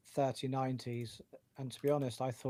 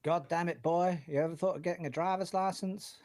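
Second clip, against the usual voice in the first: American accent; 4 semitones higher than usual. Shown as a demonstration. Brit showing co-host his best American accent, hammed-up American. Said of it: "let me hear your best American accent".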